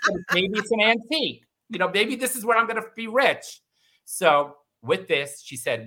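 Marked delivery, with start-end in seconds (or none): 0.1-3.6 higher, amused self-mockery; laughing at herself. Real, not acted. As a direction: laugh through the dream of being rich.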